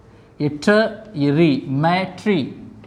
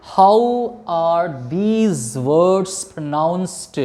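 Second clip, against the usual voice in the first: very slow, spaced-out English question; much slower than usual. Shown as a demonstration. Teacher asking students how the words are pronounced, slow and deliberate. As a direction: draw each word out slowly for learners.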